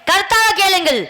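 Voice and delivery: stage king declaiming formally; measured theatrical oratory; mock-royal proclamation. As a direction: proclaim it to the whole audience, phrase by phrase. Stage king proclaims to his minister.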